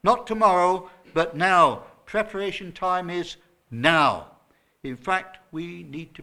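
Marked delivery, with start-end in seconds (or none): 0.0-1.8 now thrown out loud against tomorrow; loud preacher's contrast, tomorrow versus now; stress on now. Shown as a demonstration. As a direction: shout the contrast, land on now. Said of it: "The emphasis is on the word now".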